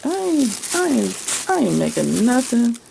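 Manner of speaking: high, repeated retold protest; mock stubborn refusal. Retelling last night's decision on the fabric, mock defiance. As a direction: refuse emphatically, playing up your own reaction.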